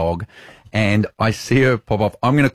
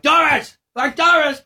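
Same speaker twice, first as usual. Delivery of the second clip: high-pitched yell to someone hard of hearing; calling out. Retold or imitated. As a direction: call the name out high across the park. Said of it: "I'm trying to yell to her".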